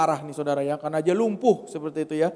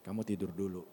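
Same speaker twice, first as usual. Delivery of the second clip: lower, much softer gentle command; a hushed inner voice; quiet voice of the Spirit; 8 semitones lower, 9 dB quieter, faster than usual. Retold or imitated. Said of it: "Roh Kudus bilang". (suara Roh Kudus yang lembut dan pelan)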